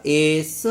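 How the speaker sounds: "एस" split into a long "e" and then "sa"; sounded-out letter. Shown as a demonstration. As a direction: draw out the first vowel of "एस", then add s. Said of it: "एस का उच्चारण जो ए से हो रहा है".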